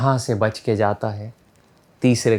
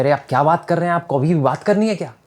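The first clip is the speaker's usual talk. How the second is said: irritated prisoner; 6 semitones higher, 4 dB louder, faster than usual. Acted.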